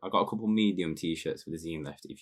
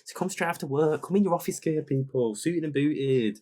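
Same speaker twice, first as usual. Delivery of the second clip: excited; 9 semitones higher than usual. Real, not acted. Hyping the event to listeners.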